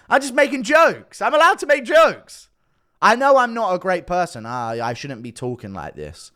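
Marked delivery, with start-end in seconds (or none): 0.1-2.5 raised, higher-pitched self-defence; indignant protest. Real, not acted. Vlogger defending his jokes to critics, indignant and high. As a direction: plead your case, exasperated.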